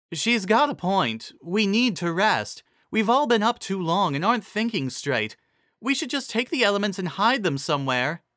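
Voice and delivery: steady the group kindly; lightly voiced Cheerilee. A narrator's reading. Cheerilee urging the tired ponies to rest, lightly voiced.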